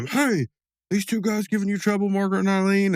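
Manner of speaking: high, mocking put-on voice; two smug guys, voiced up high; smug rival guys. Two guys confront Mordecai, Rigby.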